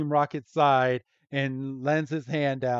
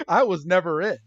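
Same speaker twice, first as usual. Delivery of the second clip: laughing; 4 semitones higher, 6 dB louder, faster than usual. Real, not acted. Joke line, laughing.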